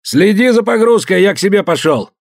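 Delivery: high loud call; calling out loudly. Acted. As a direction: call across the deck. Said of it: "позвал громко".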